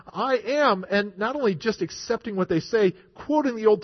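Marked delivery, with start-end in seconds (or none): 0.1-0.8 higher-pitched, assertive claim; bold declaration. Retold or imitated. Preacher voicing Jesus before his accusers, boldly declaring. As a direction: declare it with conviction. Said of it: "Boldly declaring".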